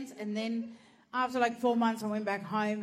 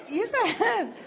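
high laughing yes; laughing insistence; 8 semitones higher, 6 dB louder than usual. Retold or imitated. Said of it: "and I said".

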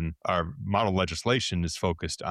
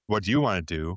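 usual speaking voice

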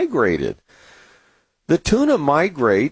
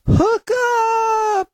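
high-pitched, drawn-out "hook up" holler; excited fishing yell. Acted. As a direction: call it out like a strike at sea. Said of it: "and yell".

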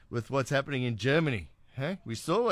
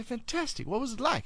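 high, softer eager quote; enthusiastic retold response; 10 semitones higher, 3 dB quieter than usual. Host retelling his reaction to the neighbour's rally, high and enthusiastic. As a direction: re-enact delighted interest, light and high.

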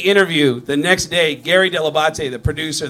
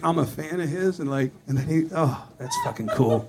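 ordinary talk

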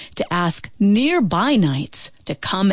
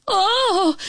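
screaming; 12 semitones higher than usual. A narrator's reading. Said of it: "screamed Radius".

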